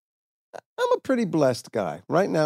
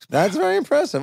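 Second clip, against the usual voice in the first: mock high awe; 11 semitones higher, faster than usual.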